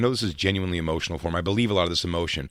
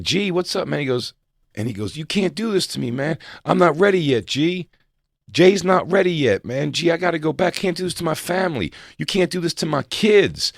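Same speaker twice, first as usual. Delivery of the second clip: higher, pleading with God; pleading Jamie voice; 7 semitones higher than usual. Retold or imitated. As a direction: beg God not to take you yet. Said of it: "he's like, I'm like".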